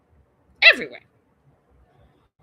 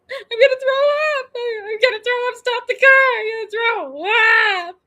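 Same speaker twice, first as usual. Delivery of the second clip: very high, loud mock bawling; imitated crying wail; 7 dB louder, slower than usual. Carsick stepson begging to stop the car, imitated crying wail. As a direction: wail it like a distressed kid, stretching the last words. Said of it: "like, crying and".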